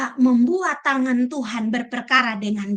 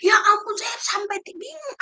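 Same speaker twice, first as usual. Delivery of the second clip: higher, exasperated plea of a troubled church member; exasperated complainer; 7 semitones higher than usual. Retold or imitated. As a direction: act out a flustered congregant at wit's end. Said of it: "Yang ketiga kali diomongin lagi". (keluhan jemaat yang kesal, nada tinggi)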